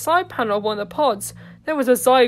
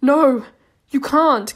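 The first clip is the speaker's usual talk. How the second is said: urgent cry; 3 semitones higher, 6 dB louder, slower than usual. Acted. Cassidy intervenes urgently.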